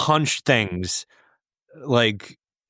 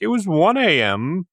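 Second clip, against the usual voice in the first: high, slow, baffled voice; bewildered quote; 6 semitones higher, slower than usual. Retold or imitated. Recalling watching the clock jump, mock baffled. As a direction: act out staring at the clock in disbelief. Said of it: "It was like".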